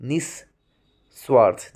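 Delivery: निस्वार्थ drawn out syllable by syllable, stretched slow; निस्वार्थ, every sound stretched; slow careful spelling out. Shown as a demonstration. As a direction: spell out निस्वार्थ slowly for the class. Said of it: "निस्वार्थ जिसे हम बोलते हैं".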